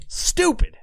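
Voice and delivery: high, sharp "stupid" at himself; self-scolding. Real, not acted. Host scolding himself about the cut finger. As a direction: call yourself an idiot in one jab.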